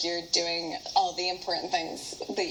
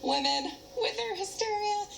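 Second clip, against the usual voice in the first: high, mocking scoff; dismissive scoff; 14 semitones higher than usual. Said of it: "He's just like".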